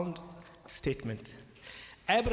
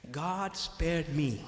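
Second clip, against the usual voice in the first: God spared me, drawn out slowly; personal, slow testimony; slow and solemn; much slower than usual. Shown as a demonstration. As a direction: let each word of God spared me land. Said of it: "we look at it this way".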